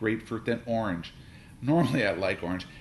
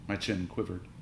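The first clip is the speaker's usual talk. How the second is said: ordinary delivery, nothing marked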